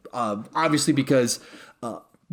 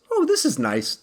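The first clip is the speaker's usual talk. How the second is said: put-on higher, content teen sigh; Peter Parker; 8 semitones higher than usual. Peter hugged by Tony, higher pleased teen voice. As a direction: melt happily into the hug. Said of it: "and Peter goes".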